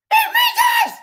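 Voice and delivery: shrill, disbelieving scream; shocked shout. Real, not acted. Repeats the news as a shout.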